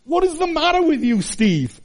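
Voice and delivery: high, exasperated scolding of himself; exasperated self-rebuke. Retold or imitated. Father scolding himself over the children, exasperated and high. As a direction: snap at yourself in frustration. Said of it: "I'd stop and think".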